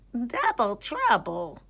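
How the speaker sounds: high, slow granny voice; lightly voiced old granny; amused granny. A narrator's reading. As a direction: savour it with an amused smile. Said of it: "said Granny Groundhog with a smile".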